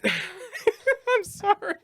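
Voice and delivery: high apology through giggles; real laughing. Real, not acted. Friend unable to stop laughing, giggling apology.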